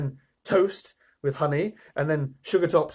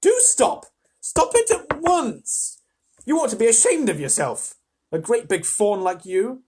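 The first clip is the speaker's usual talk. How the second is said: much higher, louder scolding; bawling scold; 7 semitones higher, 6 dB louder than usual. A narrator's reading. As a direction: scold the faun to stop crying. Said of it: "bawled Lucy into his ear".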